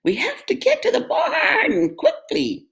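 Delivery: very high bleating sheep. A narrator's reading. Sheep panics on the way to the barn. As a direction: squeak it out like a sheep.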